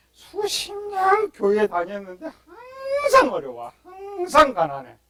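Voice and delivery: high, crying complaint of a poor believer; whining lament. Retold or imitated. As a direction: wail it like a long-suffering churchgoer. Voicing poor churchgoers' lament.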